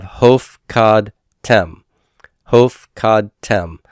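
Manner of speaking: "hof, cod, tem" with a gap between syllables. Shown as a demonstration. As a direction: sound out "hof, cod, tem" in separate pieces, then repeat. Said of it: "how I got the syllable divisions".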